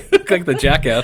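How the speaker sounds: loud laugh through the words. Real, not acted. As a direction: blurt it while cracking up. Still laughing at the face.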